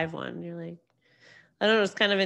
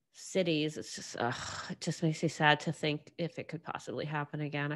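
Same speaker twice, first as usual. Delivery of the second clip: usual speaking voice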